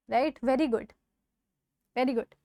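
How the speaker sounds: ordinary talk, nothing marked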